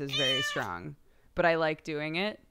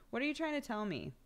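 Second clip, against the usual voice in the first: ordinary talk, nothing marked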